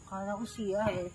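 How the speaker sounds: put-on quiet drunken mumble; blackout drunk. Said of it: "Lasing ka na".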